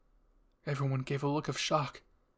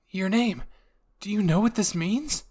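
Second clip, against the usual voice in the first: eager, raised and louder; excited; 6 semitones higher, 6 dB louder than usual. A narrator's reading. Armin to you, excited and loud. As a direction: Armin bursting with the discovery. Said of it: "Armin asked while holding your hand excitedly".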